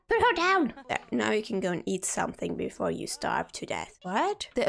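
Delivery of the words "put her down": loud high yell; yelling at the game in alarm; urgent shout. Real, not acted. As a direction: shout it in a panic.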